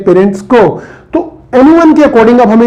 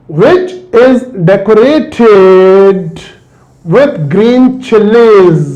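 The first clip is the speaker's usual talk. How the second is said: slow reading; much slower than usual. Shown as a demonstration. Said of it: "reads the exercise phrase slowly".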